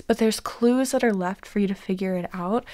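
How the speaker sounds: hushed late-night whisper. Real, not acted. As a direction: whisper so nobody wakes. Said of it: "we have been whispering because Matt is asleep".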